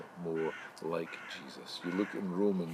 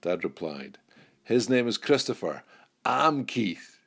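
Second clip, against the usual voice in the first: louder, lively storytelling over music; joke story narration; 6 dB louder than usual. Acted. Pastor telling the congregation a joke, lively storytelling. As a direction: tell the shopping joke with build-up.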